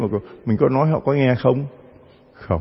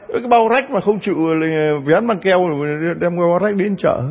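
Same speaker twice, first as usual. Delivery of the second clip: ordinary delivery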